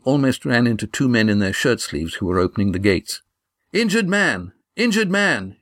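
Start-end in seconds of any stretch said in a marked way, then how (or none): 3.7-5.6 high urgent call, said twice; yell. A narrator's reading. Estrella clearing men from the gate, yelled. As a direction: cry out to clear the way, pitched high. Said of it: "yelled Estrella".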